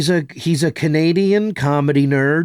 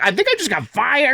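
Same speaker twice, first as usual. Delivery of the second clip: panicked; 12 semitones higher than usual. Retold or imitated. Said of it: "and I was like".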